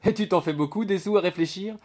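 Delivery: high, amused teasing question; lightly acted mirthful mockery; through laughter. A narrator's reading. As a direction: manage it after a laughing fit. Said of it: "finit-elle par pouvoir articuler".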